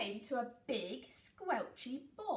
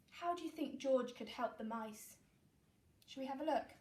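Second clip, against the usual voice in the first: ordinary delivery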